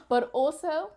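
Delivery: ordinary talk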